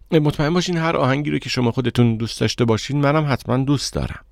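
ordinary delivery, nothing marked

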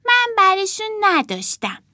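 put-on high-pitched small child's protest; little girl denying. A narrator's reading. Martha accused of taking toys, high childish denial. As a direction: deny it in a little girl's voice.

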